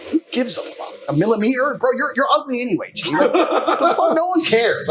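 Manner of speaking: high, laughing, scornful disbelief; mock the fussy guy with a laugh; scornful inner rant. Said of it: "I'm like".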